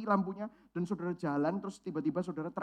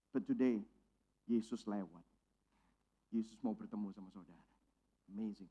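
tender hush; 9 semitones lower, 7 dB quieter than usual. Acted. Preacher softly telling a discouraged congregation Jesus passes by. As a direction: let each line sink in gently, almost a whisper.

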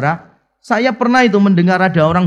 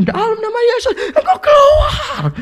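much higher, half-laughing mock shout; louder exorcism shout. Imitating a friend shouting at a demon during an exorcism, a hammed-up yell. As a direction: yell the command like a frantic exorcist. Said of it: "tambah kencang".

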